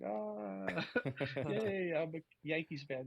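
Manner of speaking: mock cheer. Yankees fan caddying for Joe Carter, mock cheering. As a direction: imitate a badly faked yay from a pained fan. Retold dismay caddying for rival player.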